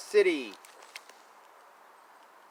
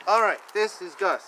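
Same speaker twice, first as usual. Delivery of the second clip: ordinary delivery, nothing marked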